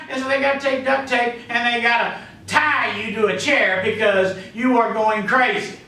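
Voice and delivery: usual speaking voice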